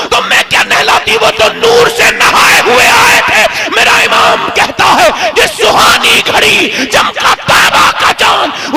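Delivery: high, loud impassioned preacher's cry; fiery preaching. Acted. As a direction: preach it at full force, voice soaring. Impassioned sermon climax.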